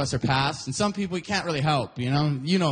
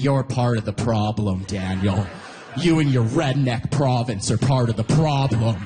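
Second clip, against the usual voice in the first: lower-pitched lecturing voice, lightly put on; mock scolding friends; 6 semitones lower than usual. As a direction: imitate disapproving friends laying blame. Friends scolding him, acted quote.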